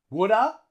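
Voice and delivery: "Woulda" with "have" reduced to a schwa; native reduction. Shown as a demonstration. Teacher gives the native form, casually reduced. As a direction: drop the V, "Woulda" as one word. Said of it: "a native English speaker would say".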